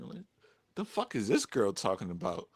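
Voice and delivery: usual speaking voice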